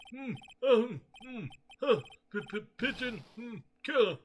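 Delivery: put-on grumpy old man. Old man ignores the visitors, grumbling in a cranky old voice.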